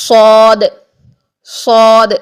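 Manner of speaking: صاد with a full, rounded aw vowel, held long; heavy saad. Shown as a demonstration. Teacher demonstrating to students the heavy letter, صاد with an aw sound. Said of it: "موٹے حروف والی آ کی sound".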